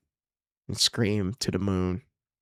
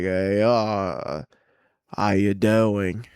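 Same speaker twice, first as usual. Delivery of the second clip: slow, louder put-on British pickup line; British flirt; 5 dB louder, much slower than usual.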